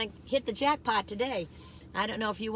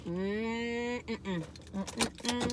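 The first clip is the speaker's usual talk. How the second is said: savoring hum; 6 dB quieter than usual. Real, not acted. Tasting the nachos.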